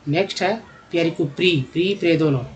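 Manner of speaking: usual speaking voice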